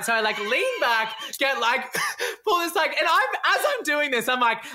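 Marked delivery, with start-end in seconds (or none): none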